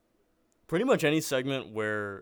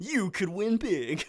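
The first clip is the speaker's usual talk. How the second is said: slow, high hammed-up ad pitch; infomercial announcer; 10 semitones higher, much slower than usual. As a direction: sell it like a TV infomercial. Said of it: "the Steam Prize infomercial".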